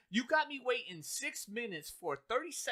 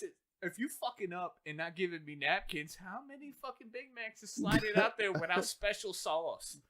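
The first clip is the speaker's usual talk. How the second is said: ordinary talk, nothing marked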